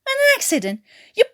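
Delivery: high loud incredulous cry; outraged disbelief. Acted. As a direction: fling the word back at him. Newkirk incredulous and furious.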